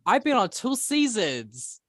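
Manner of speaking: high, loud indignant complaint; mock rant. Player mock-ranting to the group about her experience. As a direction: puff up and rant, pitch way up. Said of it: "This is my rant era".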